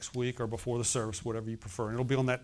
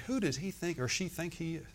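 sniffy disdain; 3 semitones higher, 7 dB quieter than usual. Retold or imitated. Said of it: "They'll look down their nose and say".

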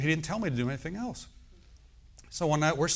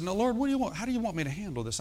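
higher-pitched appeal to God; pleading prayer; 7 semitones higher, faster than usual. Retold or imitated. Preacher retelling his prayer about the growth, pleading. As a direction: put the question up to the Lord, raised pitch.

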